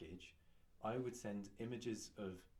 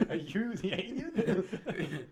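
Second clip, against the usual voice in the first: laughing; 11 semitones higher, 18 dB louder, much slower than usual. Real, not acted. Laughing at friend's gibberish.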